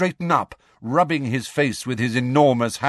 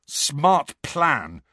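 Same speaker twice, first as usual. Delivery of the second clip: sarcastic, higher jab; bitter, furious sarcasm; spat; 4 semitones higher than usual. A narrator's reading. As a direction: spit it out at a friend. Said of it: "he spat at Hermione".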